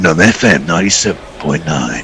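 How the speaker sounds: deep radio DJ station ident. Shown as a demonstration. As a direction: announce it like a radio DJ. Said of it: "You need to do that in a D J voice".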